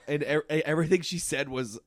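normal talking voice

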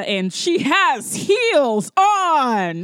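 slow, loud, scandalised emphasis on each word; mock outrage. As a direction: play up the scandal, stretching every word. Mock outrage at film character's shoes.

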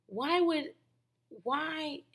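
high-pitched, halting disbelief; baffled, searching questions with long stalls; acted bafflement. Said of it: "A lot of it I'm like".

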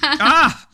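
high loud laughing yelp; laughing shriek. Real, not acted. Recoils, laughing.

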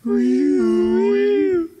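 high siren wee; mouthed car alarm. Pretend. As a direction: sound the alarm with your voice. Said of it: "Anyway, where was I?".

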